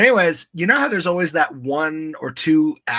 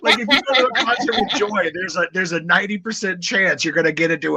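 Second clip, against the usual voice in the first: talking through laughter; giggling while teasing; laughing; 4 semitones higher, faster than usual. Real, not acted.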